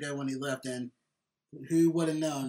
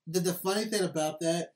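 usual speaking voice

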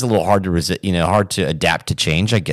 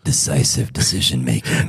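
low, soft, deep voice; deep Kerry voice; 8 semitones lower, 4 dB quieter than usual. Pretend. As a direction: drop into a deep voice. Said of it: "Say that in that deep Kerry voice".

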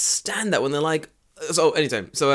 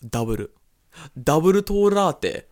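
Japanese-accented English, drawn out and higher; heavy Japanese katakana pronunciation; 4 semitones higher, 3 dB louder, slower than usual. Shown as a demonstration. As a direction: stretch it into slow katakana syllables. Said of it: "you can also say, ... too much fucking katakana".

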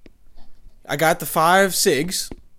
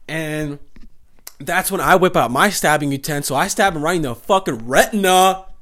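ordinary talk, nothing marked

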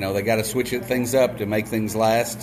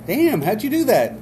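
amazed; 9 semitones higher than usual. Retold or imitated. Joke: amazed barfly asks.

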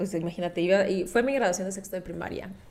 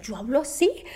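put-on Candy dub accent; 2 semitones higher, 12 dB louder than usual. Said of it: "según yo hablaba con Candy Candy".

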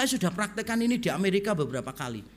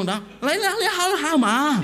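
loud, high-pitched fake Mandarin outburst; mock Mandarin; 7 semitones higher, 5 dB louder than usual. Preacher bewildering old driver, loud mock Mandarin. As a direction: belt out nonsense Mandarin, soaring high. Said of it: "saya jawab pakai Mandarin".

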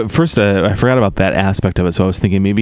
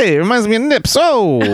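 put-on old borscht belt comic; 11 semitones higher than usual. Comic bit, high voice.

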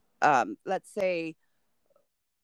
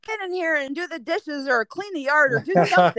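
loud, raised-pitch order to a son; mock parental yell; 8 semitones higher, 7 dB louder than usual. Dad telling his son to do chores, yelling. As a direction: holler it like a nagging parent. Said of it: "yell at my son".

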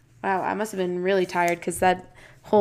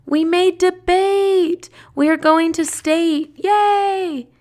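higher, louder, slow triumphant diary read; excited cheer; 9 semitones higher, 5 dB louder, slower than usual. Acted. Reading her old diary about making state, cheering. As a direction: cheer like a thrilled kid.

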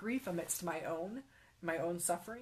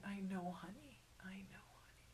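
hushed, tender whisper, much softer; tearful comfort; 2 semitones higher, 12 dB quieter than usual. Retold or imitated. Grandma holding her weeping granddaughter, soft tearful whisper. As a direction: breathe it like a grandma soothing a child. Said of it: "tears flowing down her cheeks".

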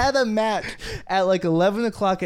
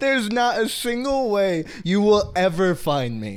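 louder, higher emphatic taunt; 4 semitones higher, 4 dB louder than usual. Acted. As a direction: taunt an opponent, stressing each word. Mock taunting an online opponent.

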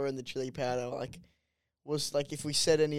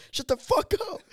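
high, strained impression of yelling dad; mock a furious yell, pitched right up; 14 semitones higher than usual. Son retelling dad's answer to being shushed, high mock yell. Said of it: "yelling at the top of his lungs".

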